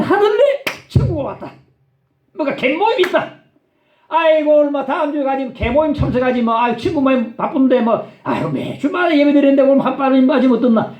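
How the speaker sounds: high-pitched, mock excuse-making; impassioned pulpit mimicry of a reluctant churchgoer; animated preaching. Preacher acting out excuses at full pitch.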